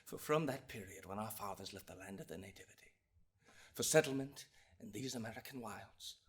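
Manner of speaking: very soft, low address to the bench; grave near-whisper; solemn hush. Acted. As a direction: speak it low and reverently, with long pauses. Solemn turn in the oration.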